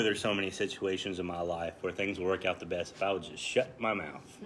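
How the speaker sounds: normal talking voice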